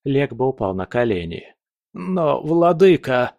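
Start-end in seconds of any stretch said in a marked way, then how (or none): 1.9-3.3 high slow desperate plea; pleading. Acted. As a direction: beg for mercy. Kneeling servant pleads.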